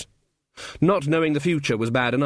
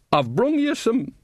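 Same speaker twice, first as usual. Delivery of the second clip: put-on cheery old woman voice; 10 semitones higher than usual. Nanny Ogg voiced.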